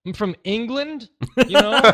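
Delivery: high, laughing indignant retort; high mock indignant friend, laughing through; friend's reply. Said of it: "And he's like".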